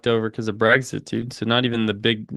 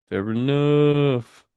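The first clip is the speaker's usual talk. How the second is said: drawn-out fair enough; 3 semitones higher, much slower than usual. Acted. Streamer repeats the remark, drawn out.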